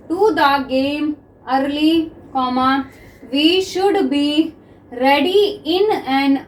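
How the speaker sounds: very slow, word by word with pauses; slow dictation. Shown as a demonstration. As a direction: dictate each word so students can write. Teacher dictates sentences slowly.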